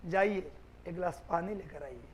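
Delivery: a soft polite Hindi request; polite, loving request. Shown as a demonstration. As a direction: say it kindly, with affection. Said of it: "बाकी प्यार से बोलें तो".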